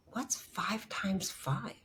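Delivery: breathy whispered question, slow; hushed bewildered whisper; confused whisper. Retold or imitated. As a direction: whisper it, lost and dazed.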